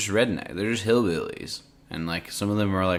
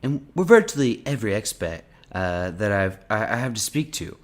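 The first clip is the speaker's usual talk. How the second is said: put-on Trump accent. Reading an interview transcript to a friend, in Trump's accent. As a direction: put on the president's accent, pitched up. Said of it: "in a Trump accent?".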